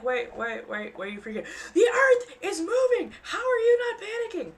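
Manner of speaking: rising to high, loud frantic pleading; mock panic. East Coaster asking the calm Californian, mock frantic plea. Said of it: "You're like".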